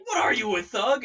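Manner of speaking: loud, high accusing yell; a knight yelling at the rude lady. Retold or imitated. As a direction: shout him down. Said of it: "starts yelling at this lady".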